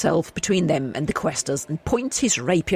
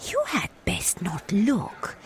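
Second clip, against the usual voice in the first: youngest elder, cautioning; 3 semitones higher, much slower than usual. Acted. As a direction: caution them with slow, airy solemnity. Voices the youngest elder, warning.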